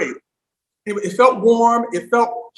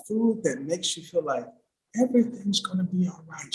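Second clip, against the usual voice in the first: softer, whispered everything's gonna be all right; hushed quote; 9 dB quieter than usual. Acted. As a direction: breathe the line like a secret. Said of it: "when she said at the last line".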